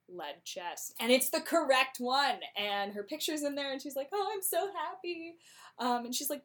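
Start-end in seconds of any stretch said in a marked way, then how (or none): 4.1-5.4 happy. Retold or imitated. Portia delighted.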